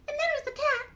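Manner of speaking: high puppet voice, counting off; Mimi. Acted. Mimi recounts the guests, high puppet voice. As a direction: recite as a tiny character.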